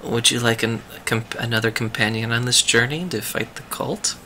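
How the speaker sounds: soft, low-level hushed aside; whispering. Acted. Druid privately asking Finbar to join, whispering. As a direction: lean in and ask it very quietly. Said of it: "goes to Finbar and whispers".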